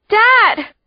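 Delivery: high, loud scream for father. A narrator's reading. Girl screaming for her father. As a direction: scream into the dark. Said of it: "I scream again".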